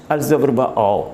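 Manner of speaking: normal talking voice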